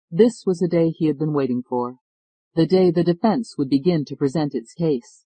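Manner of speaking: ordinary delivery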